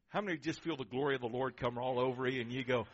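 ordinary delivery

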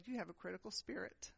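a soft, quiet word of correction; gentle rebuke. Retold or imitated. Holy Spirit correcting the angry preacher, softly. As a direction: speak it low, like a still inner voice. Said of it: "the Holy Spirit spoke to me, and he said".

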